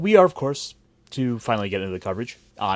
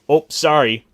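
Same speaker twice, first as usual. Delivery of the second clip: Midwestern apology; 4 semitones higher, 6 dB louder, slower than usual. Acted. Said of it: "you apologize to the door".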